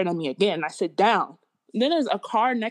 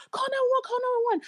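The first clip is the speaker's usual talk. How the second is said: imitated panicked mom; faster than usual. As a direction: mimic a panicking mother calling for help. Retells mom urging 911 call.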